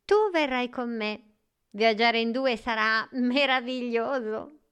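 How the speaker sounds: put-on sweet storybook child voice, lifted; smiling boy. A narrator's reading. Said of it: "Sorridendo gli disse".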